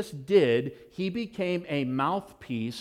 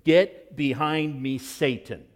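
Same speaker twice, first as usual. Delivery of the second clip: slow, hard rebuke with GET punched; slowed, stern command; forceful rebuke; 4 dB louder, slower than usual. Acted. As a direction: stop and turn, then hit GET behind me. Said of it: "he says this".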